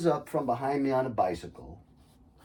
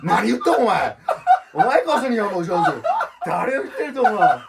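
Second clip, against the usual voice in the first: aggressive Kansai Japanese, higher and louder, laughing through it; Kansai Ben blast; 12 semitones higher, 11 dB louder than usual. Retold or imitated. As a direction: fire off rough Kansai dialect like a shotgun blast. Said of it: "shotgun blast of, like, Kansai Ben in their face".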